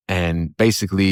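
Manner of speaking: usual speaking voice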